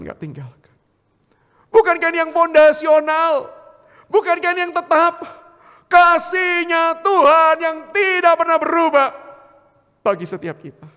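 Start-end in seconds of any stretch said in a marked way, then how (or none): 1.7-10.9 loud, high, ringing rhetorical questions; full-force preaching. Real, not acted. Preacher proclaiming God's unchanging love to congregation, full-force preaching. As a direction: proclaim it at full power, stretching key words.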